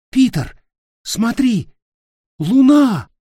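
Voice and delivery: high, loud, amazed call; excited shout. Acted. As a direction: call out in wonder. Nick sees the moon.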